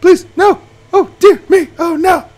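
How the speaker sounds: high, loud real distress; dismayed pleading. Real, not acted. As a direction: beg the game to stop. Car tumbles down the hill.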